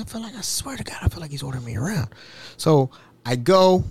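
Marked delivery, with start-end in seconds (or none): 0.0-2.1 hushed, resentful mutter to yourself; muttered aside. Retold or imitated. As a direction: grumble very softly under your breath. Retold grumbled aside about being ordered.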